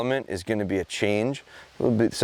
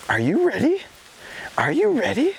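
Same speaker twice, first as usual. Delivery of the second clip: much higher, eager "are you ready" to a dog; excited dog talk; 13 semitones higher than usual. Real, not acted. Trainer hyping his bird dog, high and eager. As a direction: rev the dog up before the retrieve.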